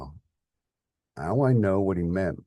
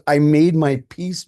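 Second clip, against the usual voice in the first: slow, loud, sneering repeat; mocking angry echo; 9 semitones higher, 11 dB louder than usual. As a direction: throw his words back with contempt. Son mocks father's words.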